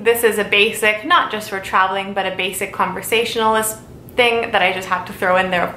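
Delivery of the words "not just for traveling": usual speaking voice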